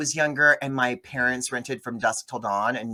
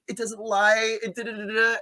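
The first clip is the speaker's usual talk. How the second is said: whiny rant; 8 semitones higher than usual. Retold or imitated. Recapping Lena's rant.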